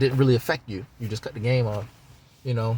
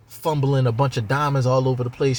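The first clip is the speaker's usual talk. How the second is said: usual speaking voice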